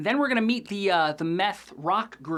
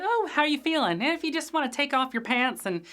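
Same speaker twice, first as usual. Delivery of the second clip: higher, sweet coaxing pastor voice; higher, syrupy churchy invitation; Glenn impression; 5 semitones higher than usual. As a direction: coax like a creepy youth pastor. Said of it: "how good I am at that character".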